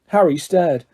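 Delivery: plain reading voice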